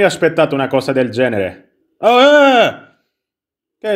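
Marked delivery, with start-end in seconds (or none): 2.0-2.7 high, loud shout of surprise; real startled yelp. Real, not acted. Player jolted by what just happened, high loud startled yelp. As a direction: yelp as if caught off guard. Sobbalza per il gioco.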